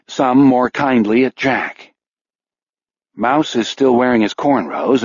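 ordinary delivery

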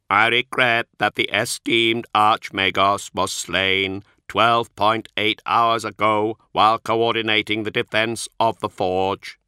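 slow, measured, strangely inflected machine voice; a polite machine reciting its excuses; synthetic Magos voice. Acted. The Magos explaining to the Astartes, a slow synthetic voice. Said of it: "Archoi's synthesized voice".

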